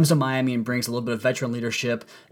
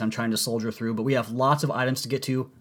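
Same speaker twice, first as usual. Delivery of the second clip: ordinary talk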